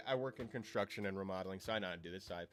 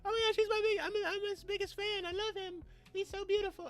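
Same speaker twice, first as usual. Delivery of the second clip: very high squealing fan voice; gushing fangirl; 3 dB louder than usual. Retold or imitated. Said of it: "They're like".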